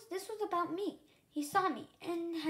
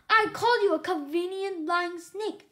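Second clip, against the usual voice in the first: loud, contemptuous insult spat out; 4 semitones higher, 10 dB louder than usual. A narrator's reading. Young dragon insulting the elder Mauser, spat out loud. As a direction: spit the insult in his face. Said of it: "I spat".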